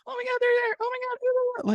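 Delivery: high squealing fangirl parody; mock the screaming young fans; shrill-pitched gushing fan impression, sneering. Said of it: "I see all these videos like".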